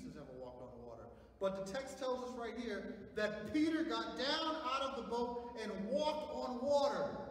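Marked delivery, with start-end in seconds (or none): 1.4-7.3 loud, high, slow proclamation; full-force preaching. Acted. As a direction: ring it out to the congregation.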